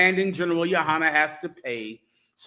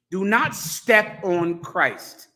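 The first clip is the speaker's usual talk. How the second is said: slow warning; much slower than usual. Acted. Preacher warning the congregation.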